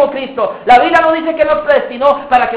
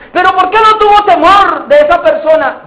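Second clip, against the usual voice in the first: interrogating judge; 5 semitones higher, 3 dB louder than usual. Acted. Trial questioning the accused.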